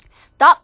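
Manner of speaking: first "stop" said near "stahp"; American O. Shown as a demonstration. Teacher showing American vowel, "stop" as "stahp". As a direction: open the o of "stop" towards ah. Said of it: "미국 사람들은 왠지 아에 가깝게".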